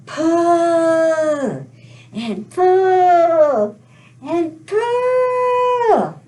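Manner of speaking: long drawn-out, rising pulls; straining heave. Pretend. Storyteller leading everybody to pull the turnip, long effortful heave. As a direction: draw out each PULL as if hauling hard.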